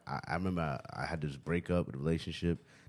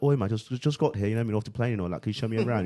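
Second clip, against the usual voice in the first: put-on British accent, higher and louder; 3 semitones higher, 3 dB louder, faster than usual. Chatting up a girl, in a fake British accent. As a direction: charm her as a tourist just off the plane. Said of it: "talked to a girl like".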